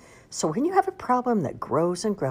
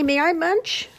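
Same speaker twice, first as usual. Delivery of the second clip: much higher, louder hopeful bunny voice; bunny asking; 13 semitones higher, 5 dB louder than usual. Acted. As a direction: ask sweetly as a small bunny. Bunny voiced in children's story.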